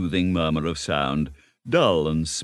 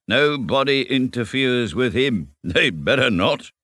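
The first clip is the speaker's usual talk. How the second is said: slow, weighty hint; significant warning; 6 semitones higher, 3 dB louder than usual. Acted. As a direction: lean on it slowly, as a veiled warning. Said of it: "he added significantly".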